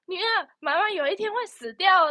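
high, cheerful mom to daughter; a chirpy parent's warning; mock mother voice. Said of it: "从小听到大".

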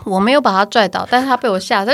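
usual speaking voice

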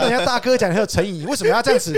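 提高音量、语调拔高地抗议，带着笑意重演; retold angry protest. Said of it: "我就很生气". (对老爸不服气地抗议，模仿当年生气)

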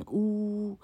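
U held long as a pure "oo"; 拆音示范. Shown as a demonstration. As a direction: stretch U out as the end of the glide. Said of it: "还要念U". (把U拉长念成“呜”)

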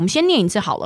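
ordinary talk

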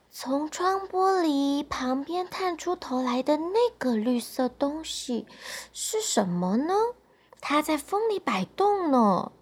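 put-on soft voice of a sick girl thinking; weak, wondering child. A narrator's reading. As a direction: wonder quietly to yourself, with little strength. (生病小女孩轻声地想着)

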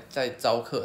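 normal talking voice

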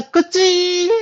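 put-on high cooing baby-talk voice. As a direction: coo at a toddler, sweet and high. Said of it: "每天对小朋友说". (娃娃音逗小孩)

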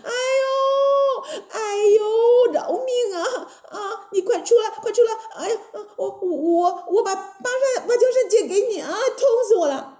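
high, hammed-up agonized begging; whimpering, stammering storybook villainess; wailing in pain. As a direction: plead for mercy while writhing in pain. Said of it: "疼着铁扇公主倒在地上一直打滚呢".

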